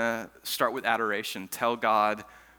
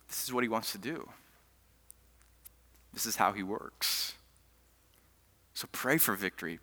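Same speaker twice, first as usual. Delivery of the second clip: soft, lower, gentle preaching; quiet sermon; 3 semitones lower, 5 dB quieter than usual. Acted. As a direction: draw the room in close.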